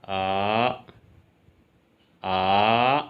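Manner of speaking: आ in Mandarin third tone; falling-rising third tone. Shown as a demonstration. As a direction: dip आ down then bring it up. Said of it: "third tone लगा दी जाए तो इसकी sound".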